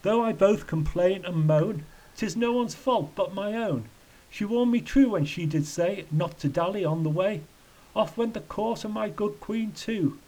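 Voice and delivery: high, mildly anguished recital; acted lament rising at the end; shrieked lament. A narrator's reading. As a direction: lift it into a high complaint. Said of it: "he shrieked".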